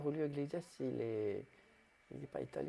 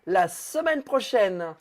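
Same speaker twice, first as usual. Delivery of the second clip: grand announcement; 5 semitones higher, 6 dB louder than usual. Pretend. Grand announcement to absent audience.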